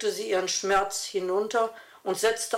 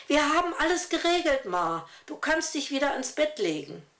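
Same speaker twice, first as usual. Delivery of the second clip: lightly voiced boy, pitched up and pleased; a boy proudly reporting to his mother; a hint of a young boy's voice, proud; 6 semitones higher than usual. Young son Tim reports proudly.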